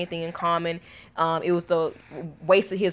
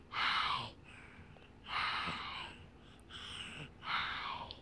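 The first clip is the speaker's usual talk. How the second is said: whispered hi; 5 semitones lower, 20 dB quieter, much slower than usual. Real, not acted. Mom greeting her baby, soft whispered hi. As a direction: barely breathe hi at a tiny face.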